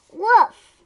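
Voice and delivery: high, loud excited woof; loud dog bark. Acted. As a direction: bark it out big.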